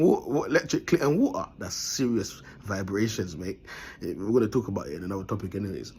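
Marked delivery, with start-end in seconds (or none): none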